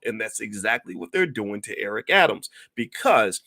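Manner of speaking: ordinary delivery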